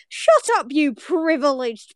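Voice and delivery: high, punched opening, then drawn out; mock exasperation. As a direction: snap back in playful outrage. Mock outburst at complaining southerners.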